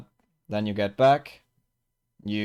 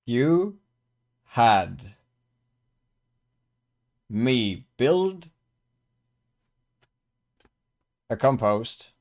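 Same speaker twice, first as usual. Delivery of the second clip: louder, halting disbelief with long pauses; exasperated protest; 3 semitones higher, 7 dB louder than usual. Acted. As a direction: spell out the grievance word by word. [name] protests moving his compost.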